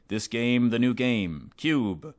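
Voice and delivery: loud hyped trailer voice; ad announcer parody. Retold or imitated. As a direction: sell it like a cheesy game ad. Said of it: "the ad is just like".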